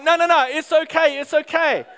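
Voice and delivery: high, loud, urgent calming-down; frantic reassurance. Retold or imitated. Hastily calming screaming kids in story.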